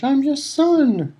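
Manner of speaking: very high, slow anguished cry; drawn-out scream. A narrator's reading. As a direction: stretch every word in despair. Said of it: "he screamed up at Crouch".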